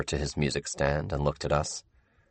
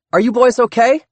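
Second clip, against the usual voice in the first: Dot calling out; 9 dB louder than usual. Acted.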